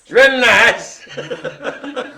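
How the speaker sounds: high, loud, stretched-out imitation of an American accent; mock American accent. Host mimics Brian's attempt, laughing mock American accent. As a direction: mimic a bad American twang, half laughing. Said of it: "Give us your American accent".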